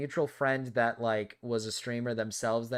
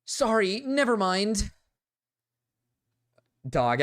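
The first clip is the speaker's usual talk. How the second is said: ordinary talk, nothing marked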